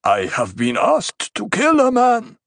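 high, projected man's shout. A narrator's reading. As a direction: call it out from across the crowd. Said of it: "a voice shouted from off to the right".

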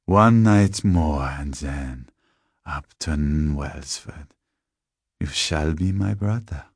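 very slow, portentous vampire; lingering, falling villainous cadence; sinister tall man. Acted. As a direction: draw out the promise with relish.

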